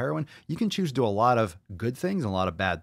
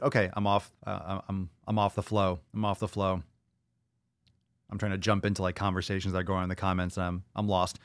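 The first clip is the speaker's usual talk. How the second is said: ordinary talk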